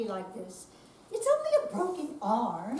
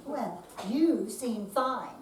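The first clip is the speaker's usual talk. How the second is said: ordinary talk, nothing marked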